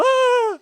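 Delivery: very high, loud "aah"; mock scream. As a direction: imitate a suspect's high-pitched scream. Acting out the startled suspect's cry.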